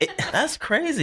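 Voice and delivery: surprised. Retold or imitated. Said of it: "I was like".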